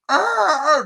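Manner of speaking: high, loud mock police siren; siren imitation. Police cars showing up, mimicked high and loud. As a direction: wail like a squad car arriving.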